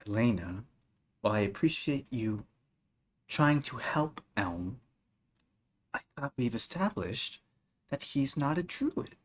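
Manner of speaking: soft, quiet measured reproach; stern quiet mother. Acted. Lady Fae quietly rebuking Elena for her scheme, soft and measured.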